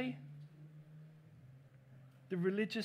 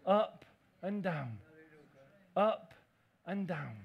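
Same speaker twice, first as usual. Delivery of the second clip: "up and down" drawn out slowly; slow rocking emphasis; slowed repeat; 4 semitones lower, slower than usual. Acted. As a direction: stretch each word of "up and down".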